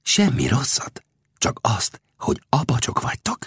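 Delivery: hushed, deliberate whispering; whisper in the ear. A narrator's reading. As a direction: whisper it close into someone's ear. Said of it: "és a fülébe súgta".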